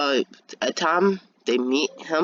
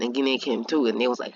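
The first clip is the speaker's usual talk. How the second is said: plain reading voice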